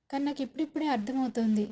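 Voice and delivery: usual speaking voice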